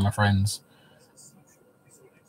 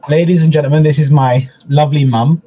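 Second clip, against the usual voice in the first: louder showman introduction; mock announcer; 3 semitones higher, 8 dB louder than usual. Host introduces his mum to viewers, mock announcer voice. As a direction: present her like a stage act.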